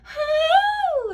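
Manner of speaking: very high, loud resonant note; a single ringing top note; high note demo. Shown as a demonstration. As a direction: send a high note through the nose. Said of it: "back in here in the throat and into the nose".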